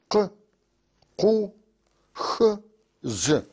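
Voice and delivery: each letter read alone, with a pause after it; reading letters aloud. Shown as a demonstration. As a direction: read the Circassian letters one by one, slowly. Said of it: "نقراهن". (قراءة الأحرف الشركسية حرفًا حرفًا)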